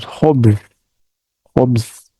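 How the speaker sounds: khubz with a rough throat kh; harsh kh in khubz. Shown as a demonstration. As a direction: scrape the kh of khubz. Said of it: "So you see how the sound is made".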